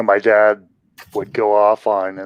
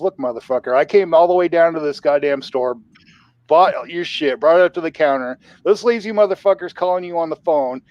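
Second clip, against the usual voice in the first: retold customer tirade, pitched up; imitating angry dad; 7 semitones higher than usual. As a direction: lay into the clerk as an indignant dad. Retold angry dad berating clerk.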